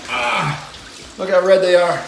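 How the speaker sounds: loud startled cry at red legs; surprised exclamation. Real, not acted. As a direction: blurt it out, shocked and loud. Getting out of ice bath.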